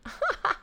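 high yippy cackle; high jackal cackle. Acted. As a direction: giggle like a jackal. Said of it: "The jackal shook his head and muttered".

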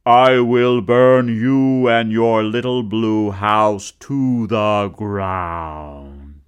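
loud, slow menacing growl; growled threat. Acted. Man in the sun threatening the woman, loud slow growl. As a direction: lean in and threaten. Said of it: "growled the man in the sun".

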